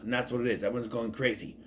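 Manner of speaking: ordinary talk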